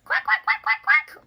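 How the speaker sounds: high, loud little-duck quacking; loud duckling quacks. A narrator's reading. Ducklings quacking loudly at the speeding cars. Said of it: "just as loud as their little quackers could quack".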